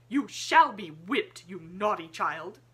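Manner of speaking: loud, slow threatening scold; angry schoolmistress voice; stern scolding. A narrator's reading. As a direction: threaten the naughty child with a whipping. Miss Minchin scolding Lottie.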